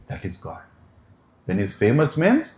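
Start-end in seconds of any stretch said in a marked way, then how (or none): 0.1-0.6 hushed, breathy whisper. Real, not acted. Telling listeners who God is, in a hushed whisper. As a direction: let it drop to a reverent whisper. Reverent aside after dance.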